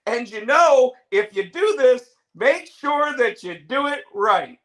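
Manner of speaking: mock Jericho. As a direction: lecture slowly like a smug veteran. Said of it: "when he's like".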